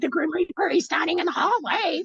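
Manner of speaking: high, panicked voice, retelling his terror; panicked quote. Retold or imitated. Imitating her partner's high panicked cry about the Grim Reaper in the hallway. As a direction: pitch way up as if seeing the Grim Reaper. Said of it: "He's like".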